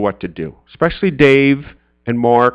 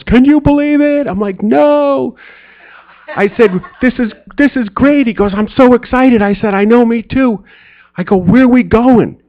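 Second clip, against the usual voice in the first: excited retelling; 8 semitones higher than usual. Retold or imitated. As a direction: relive the giddy phone call. Excited phone call with Mark.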